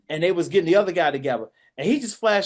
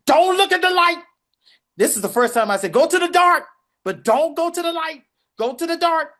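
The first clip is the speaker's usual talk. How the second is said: high, loud desperate urging; urgent pleading call; 10 semitones higher, 5 dB louder than usual. Retold or imitated. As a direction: beg him to stay alive. Kid urging dying man to hold on.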